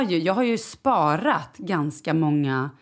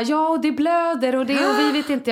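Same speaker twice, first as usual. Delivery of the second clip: much higher, louder worried rush; flustered teacher; 8 semitones higher, 4 dB louder than usual. Retold or imitated. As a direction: imitate the staff sounding alarmed. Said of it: "Och de bara".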